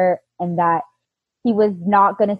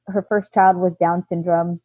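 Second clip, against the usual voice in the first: ordinary talk, nothing marked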